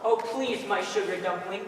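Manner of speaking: ordinary talk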